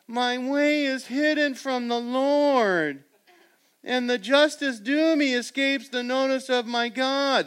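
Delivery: high, slow, whining complaint; mock the people's self-pity; a hammed-up grumble, voice up high; voicing the complainers. Shown as a demonstration. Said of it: "I'm trying to read it accurately".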